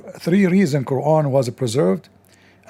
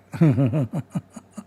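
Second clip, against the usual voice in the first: mocking laugh. Real, not acted. Mocking the raccoon's school.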